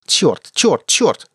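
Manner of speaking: high, sharply falling repeated curse; frustrated cursing. A narrator's reading. Gunner watches pirates escape, cursing. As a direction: swear over and over in frustration.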